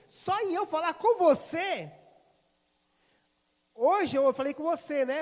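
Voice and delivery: high, loud and slow with wonder; amazed. Retold or imitated. Businesswoman marvelling to the pastor about their talk. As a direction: stretch it out in disbelief.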